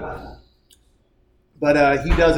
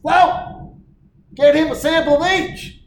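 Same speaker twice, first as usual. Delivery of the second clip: much higher Kentucky drawl; Kentucky accent; 13 semitones higher, 5 dB louder, slower than usual. Retold or imitated. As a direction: push the sample like a Kentucky moonshiner. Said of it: "get this accent down".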